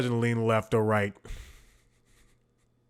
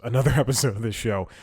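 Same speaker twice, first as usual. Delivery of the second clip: ordinary talk, nothing marked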